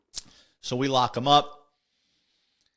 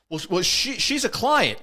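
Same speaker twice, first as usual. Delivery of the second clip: high, louder, stammering mock panic; mock flustered excuse; 8 semitones higher, 4 dB louder than usual. Driver excusing his companion to police, mock flustered. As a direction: imitate someone caught out, stumbling upward in pitch. Threatened driver explains himself.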